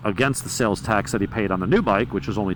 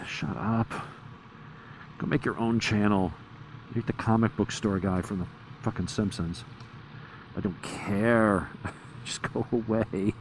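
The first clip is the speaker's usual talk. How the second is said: dismissive mutter; 9 dB quieter than usual. Real, not acted. Dismissing the imagined critic.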